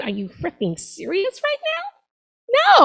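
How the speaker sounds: high, slow, disbelieving squeal; incredulous. Acted. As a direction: drag it out in outraged disbelief. Outraged at Facebook friend request.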